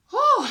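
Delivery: very high, loud whew; emotional whew. Real, not acted. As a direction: let out a high, overwhelmed whew. Overcome after recounting emotional dream.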